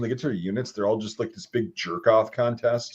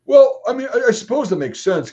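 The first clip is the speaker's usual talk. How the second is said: mock MP reply; 11 semitones higher, 7 dB louder than usual. Retold MP's reply.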